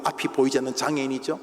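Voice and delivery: ordinary delivery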